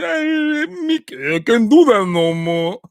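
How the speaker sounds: high mock-weeping boxer, slurred; Rocky impression. Pleading with dying Mick, tearful mock Rocky impression. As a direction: wail it as a hammed-up grieving fighter. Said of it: "when Mick dies".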